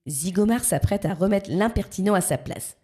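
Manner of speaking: ordinary delivery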